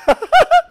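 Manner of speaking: sudden high laugh at a student; high burst of laughter. Real, not acted. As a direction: let out a squeaky laugh. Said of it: "laughs at student". (risada aguda e repentina)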